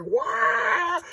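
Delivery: high, loud outraged yelp; hammed-up incredulous outburst; freaking out. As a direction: shout it in mock disbelief. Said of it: "Thanos is just freaking out".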